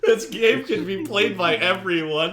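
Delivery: laughing through a promo line; giggling ad voice; laughing mock announcer.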